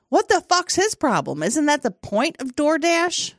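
high-pitched baffled protest; high, aggrieved inner rant; retold indignation. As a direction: question it with rising disbelief. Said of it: "started thinking".

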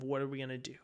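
ordinary talk

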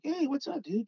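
high-pitched mock hail to an old buddy; retold friendly greeting. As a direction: call out a retold hello, pitch way up.

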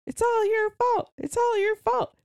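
put-on high shrill kid chant, shouted; kid screaming. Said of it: "your kid is screaming".

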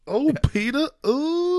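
high, breathy swoon over Peter; mock a fainting belle, way up high; hammed-up falsetto swoon; swooning Southern belle. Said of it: "Wendy's now in a Tennessee".

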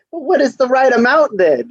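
high, exasperated question. Retold or imitated. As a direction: throw up your hands asking. Exasperated retold reply.

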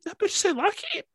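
high-pitched mock shocked quote; mock outrage. Retelling a movie surprise in a mock high voice. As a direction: squeal it like a scandalized gossip.

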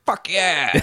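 high-pitched gleeful cheer; cheering movie grandpa. Retold or imitated. Grandpa from a movie hailing cop fights, gleeful cheer. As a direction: whoop it like a fired-up grandpa. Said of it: "Grandpa from last week's movie is like".

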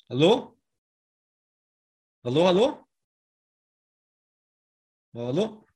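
calling hallo into a dead line; shouting on bad phone line. Real, not acted. Connection dropped, calling out.

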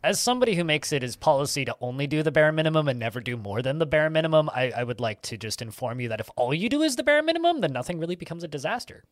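ordinary talk, nothing marked

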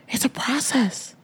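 soft unvoiced whisper of it's a process. Real, not acted. Host whispering to listeners about the immigration process.

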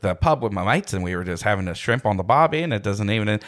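broad, casual put-on Aussie; Australian accent. Shown as a demonstration. Voice actor showing off at the pub story, Australian accent. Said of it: "I do Australian accents".

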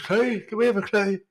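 high mock nagging for a clue; whiny nagging. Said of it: "every twenty minutes going on".

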